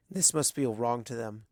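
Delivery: ordinary delivery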